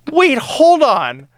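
high, slow, indignant halt; retold offended disbelief; mock affronted protest. Acts out offended reply to kid.

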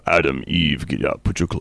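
low, booming God voice; God throwing Adam and Eve out, low. Pretend. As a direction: drop low and command like God.

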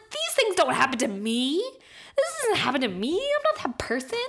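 talking herself out of it in disbelief; rising protest that ends in a high squeak; disbelieving self-doubt. Retold or imitated. Quoting her own disbelief.

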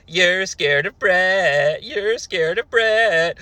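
slow taunting chant; mock taunt. Sonny to Cornette, slow mocking taunt. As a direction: drag it out to taunt. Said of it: "Sonny chimes in".

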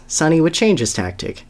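ordinary delivery

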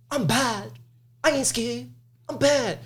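put-on high, small tough-kid bravado; a six-year-old faking courage; little kid voice. Kid bluffing at the masked scare actor, small high voice.

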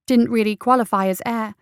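plain reading voice

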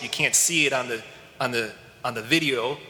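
normal talking voice

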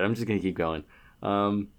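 normal talking voice

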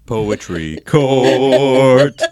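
loud, hugely drawn-out announcement; segment announcer. Pretend. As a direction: stretch each word out like a mock-grand announcer. Announcing the show's recurring segment.

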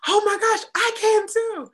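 sudden high, loud burst of hope; excited gasp. Retold or imitated. Hearing someone else's comeback story, an excited high cry. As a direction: gasp it out like the idea just hit you. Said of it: "I'm like".

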